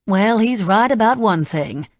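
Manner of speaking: a hint of a Southern drawl, unhurried; lightly Southern housekeeper voice; slight Southern accent. A narrator's reading. Nadine teasing Ramey, slight Southern accent. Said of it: "with a slight Southern accent".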